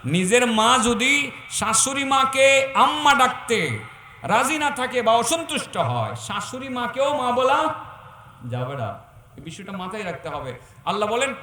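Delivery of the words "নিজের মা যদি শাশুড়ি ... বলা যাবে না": louder restated point. Acted.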